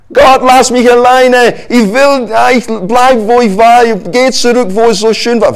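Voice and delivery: high, loud whiny plea; pouting like a child who wants to go back; whining Israelite. Pretend. Israelite begging God to let him go back, whining.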